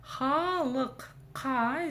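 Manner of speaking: «халық» с сильным горловым «қ» в конце; чётко выбей «К» после «халық»; усиленное «К». Shown as a demonstration. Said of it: "здесь прям двойная буква К". («халық» медленно, с двойным «К»)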